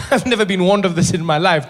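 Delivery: ordinary talk, nothing marked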